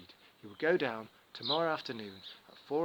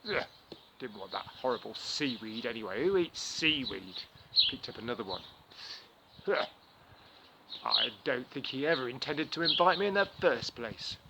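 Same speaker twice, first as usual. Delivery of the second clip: sulky grumbling; 4 dB louder than usual. Acted. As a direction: mutter like a sore loser. Anansi sulks throwing stones.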